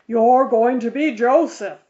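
firm father. A narrator's reading. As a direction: lay it down as final, no arguing. Minister laying down the law.

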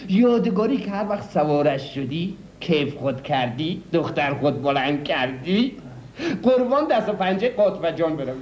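put-on a car dealer in his folksy regional Persian accent. Car dealer boasting to a buyer in a regional Persian accent. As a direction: lay on the regional Persian accent, showy and sly.

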